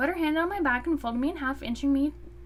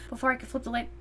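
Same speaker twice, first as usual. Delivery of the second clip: usual speaking voice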